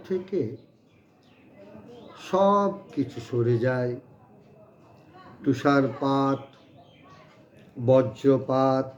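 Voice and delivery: measured poem reading with long pauses; declaimed verse, phrases set apart by pauses; poetry recitation. Acted. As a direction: recite it line by line, lingering between phrases.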